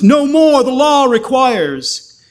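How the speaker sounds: high, loud and slow triumphant cry; crying aloud. Acted. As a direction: proclaim it like the final victory cry. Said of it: "aloud he cries".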